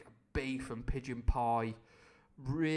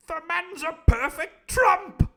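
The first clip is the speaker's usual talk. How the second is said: very high loud slow pompous declaration; the Squire declaring; 16 dB louder, slower than usual. Acted. The Squire praising Long John, high and booming. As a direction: proclaim it like a booming toff. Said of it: "declared the Squire".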